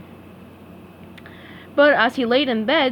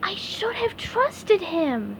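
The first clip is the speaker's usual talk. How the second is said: high, slow, regretful cartoon sponge; soft high SpongeBob, a little ashamed; 8 semitones higher, 4 dB quieter, slower than usual. SpongeBob to himself by sleeping Patrick, high regretful voice.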